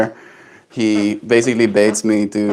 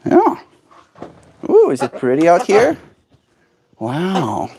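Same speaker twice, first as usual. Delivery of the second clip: high sing-song pet talk; 7 semitones higher than usual. Acted. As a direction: coax a pet gently. Coaxing pet bird outside.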